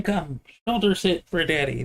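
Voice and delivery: usual speaking voice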